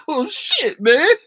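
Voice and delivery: ordinary talk, nothing marked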